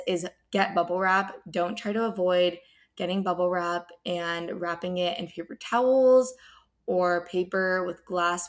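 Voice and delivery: normal talking voice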